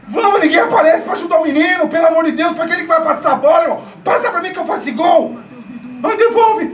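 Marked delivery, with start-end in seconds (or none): 6.0-6.6 frustrated yell. Real, not acted. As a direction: scream at the player. Fan yelling at player.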